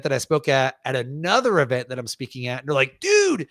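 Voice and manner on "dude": high, drawn-out holler; slow, stretched high cheer; excited fan. Retold or imitated. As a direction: imitate a thrilled fan shouting hello. Fan greeting him months later.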